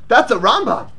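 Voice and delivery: usual speaking voice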